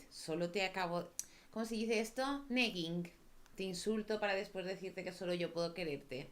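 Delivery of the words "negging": usual speaking voice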